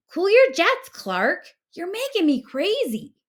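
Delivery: high, loud, fed-up cry; a frazzled little fish begging him to calm down; exasperated friend. A narrator's reading.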